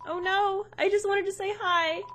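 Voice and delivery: usual speaking voice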